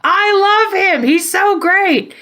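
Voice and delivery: high, gushing fangirl voice, slowing at the end; mock adoring superfan, high and breathless; gushing fan. Fans posting on Twitter.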